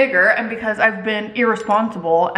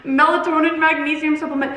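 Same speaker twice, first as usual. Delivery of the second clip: higher mock-alarmed voice; alarmed commenters; 6 semitones higher than usual. Viewers warn creator about the supplement dose, mock alarm. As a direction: imitate a scandalised commenter, pitched up. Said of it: "all of the comments were like".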